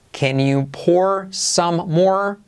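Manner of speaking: very slow, clear teacher model; slow model sentence. Shown as a demonstration. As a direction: lay each word out for a learner to copy. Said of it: "frase para trabajar la O más R genérica".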